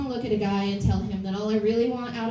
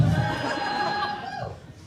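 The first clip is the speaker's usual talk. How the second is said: breathy laugh; 3 dB quieter than usual. Real, not acted.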